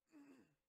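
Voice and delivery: quick high laugh. Real, not acted. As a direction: laugh at a silly trade. Laughs at bad trades.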